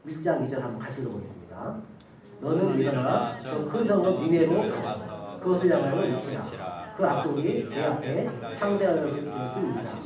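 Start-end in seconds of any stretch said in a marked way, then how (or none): 2.4-10.0 slow, measured unison Bible reading; scripture reading. Acted. Pastor leading the church in reading Jonah, slow recitation. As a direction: read the verse slowly with the congregation. Congregation reads scripture aloud.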